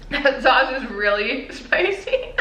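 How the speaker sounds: laughing. Real, not acted. Laughing at spicy sauce.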